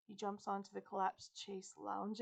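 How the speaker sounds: usual speaking voice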